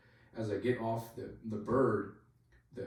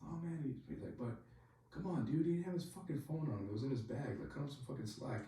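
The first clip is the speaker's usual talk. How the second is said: higher, softer mock pleading; imitated arguing; 5 semitones higher, 5 dB quieter than usual. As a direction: argue on his behalf as if overheard from outside. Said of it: "You can hear him, like, arguing".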